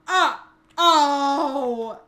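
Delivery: loud "ah" then long "oh"; loud wordless cry. Real, not acted. Streamer reacting alone on stream, loud cry. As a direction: cry out loudly, drawing out the last sound.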